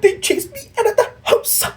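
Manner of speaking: high mock crying whine; sobbing on the phone. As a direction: blubber it into the phone. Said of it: "he's like".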